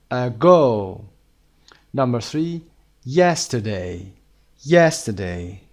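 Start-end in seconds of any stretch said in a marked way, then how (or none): 0.1-1.0 "Ago" with a loud, long "go"; second-syllable stress. Shown as a demonstration. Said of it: "Ago هم که روی قسمت دوم بود". 3.0-3.9 "Yesterday" with the first syllable punched; stress on YES. Shown as a demonstration. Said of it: "تکیه روی yes هست". 4.6-5.6 "Yesterday" with the first syllable punched; stress on YES. Shown as a demonstration. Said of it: "تکیه روی yes هست".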